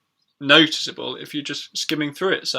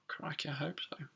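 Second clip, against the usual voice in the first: real hushed whisper to herself; whispered aside; 18 dB quieter than usual. Real, not acted. Teacher checking if the stream works, whispering to herself. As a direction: breathe it under your breath, half worried.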